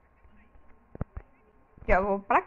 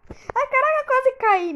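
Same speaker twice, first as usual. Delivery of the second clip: much higher, louder alarm at almost falling; startled high yelp; 13 semitones higher, 7 dB louder than usual. Real, not acted. Gamer nearly falls off the map, startled high yelp. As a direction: yelp in real fright.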